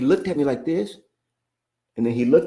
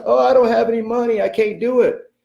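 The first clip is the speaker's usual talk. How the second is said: high, louder mock whine; whiny excuse; 10 semitones higher, 4 dB louder than usual. Mocking a listener who claims no money, high whiny voice. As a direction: mimic a helpless excuse-maker, pitched way up. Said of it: "And you're like".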